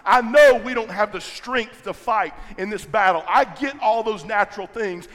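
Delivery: rising sermon intensity; preaching at full force. Acted. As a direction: drive it home to the congregation.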